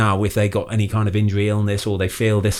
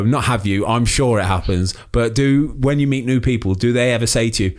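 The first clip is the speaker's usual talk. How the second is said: normal talking voice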